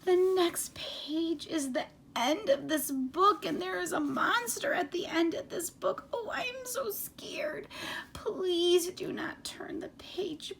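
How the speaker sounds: high, excitable furry monster pleading and boasting; Grover voice. Acted. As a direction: play Grover, scared and then proud. Reads Grover's lines in character.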